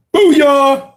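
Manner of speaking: high, very loud hype shout; screamed booyah. Real, not acted. Streamer gives a viewer the requested booyah, screamed. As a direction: belt it out into the mic. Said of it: "I probably screamed into the microphone".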